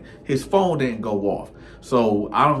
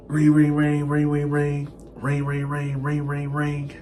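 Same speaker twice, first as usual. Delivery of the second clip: imitated phone ring; 4 semitones higher than usual. Imitating his phone ringing.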